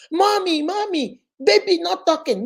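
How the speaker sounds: put-on high child's voice, worried; little girl voice. Toddler telling mommy the baby isn't talking, high child voice. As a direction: play the two-year-old tugging at mommy. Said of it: "my own baby by the hand".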